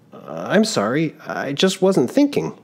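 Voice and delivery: put-on an embarrassed young man caught out; young traveler, flustered. Giovannino apologizes to constable.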